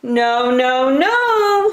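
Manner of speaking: high frightened little wolves, rising on the last no; high, timid cub voices; squeaky little wolves. A narrator's reading. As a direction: refuse in tiny scared voices. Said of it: "said the little wolves".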